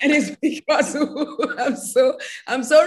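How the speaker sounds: laughing while explaining she stays relaxed. Real, not acted. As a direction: giggle it out. Laughs at own story.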